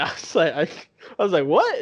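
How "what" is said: high, loud, rising disbelief; incredulous what. Retold or imitated. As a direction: re-enact being taken aback, voice shooting up. Retold startled reaction to her outburst.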